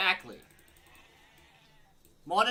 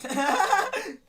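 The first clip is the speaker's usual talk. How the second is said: loud high laugh; 12 dB louder than usual. Real, not acted. Laughs at virgin joke.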